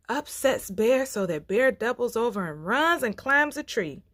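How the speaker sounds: ordinary delivery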